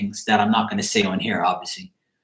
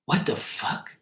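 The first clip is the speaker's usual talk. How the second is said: breathy whispered curse, much softer; whispered what the fuck; 4 semitones higher, 8 dB quieter, slower than usual. Retold or imitated. Friend whispering his shock at the exploding beer. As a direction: hush it out in disbelief. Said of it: "And he was like".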